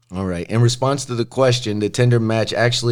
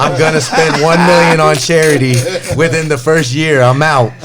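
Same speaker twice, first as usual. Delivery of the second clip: laughing while reading; 4 semitones higher, 5 dB louder than usual. Retold or imitated. Reading Kodak's tweet, laughing.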